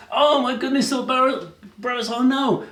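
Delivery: high, worried gasp; frightened thought. Acted. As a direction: panic under your breath. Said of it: "thought Barabbas".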